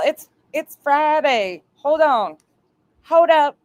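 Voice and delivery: high-pitched, drawn-out mocking exclamation; mock them in a high, excited voice. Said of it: "I'm mocking you".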